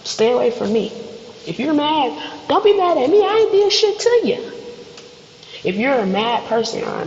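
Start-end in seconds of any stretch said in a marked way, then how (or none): 1.4-4.4 high, raised-voice protest of innocence; indignant protest. Acted. Telling off someone mad at the wrong person, indignant protest. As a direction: push back, pitch climbing sharply.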